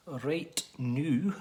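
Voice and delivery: noo said with a long oo like moo; Scottish noo. Shown as a demonstration. Teaching viewers Scottish words, noo said with a long oo. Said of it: "means right now".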